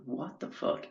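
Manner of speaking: breathy whisper of shock; whispering to himself on the ladder, alarmed. Retold or imitated. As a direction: hiss it under your breath in alarm. Shocked at the lamp.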